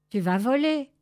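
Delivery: loud, ringing encouragement; encouraging call. Acted. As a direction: call out to lift her courage. (encouragement lancé fort)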